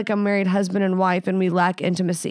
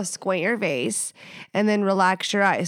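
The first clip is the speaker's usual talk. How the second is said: ordinary delivery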